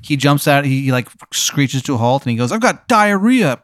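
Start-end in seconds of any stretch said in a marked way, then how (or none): 2.5-3.6 high, drawn-out mock panic; urgent outburst. Uber driver screeching to a halt, mock-urgent outburst. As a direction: blurt it out in hammed-up urgency.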